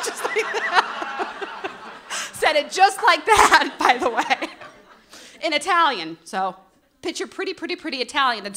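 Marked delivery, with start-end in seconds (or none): 0.0-4.5 breathless real laughter through the words; laughing. Real, not acted. Recalling Moreno saying Scranton, laughing. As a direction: giggle it out slowly.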